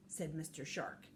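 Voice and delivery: plain reading voice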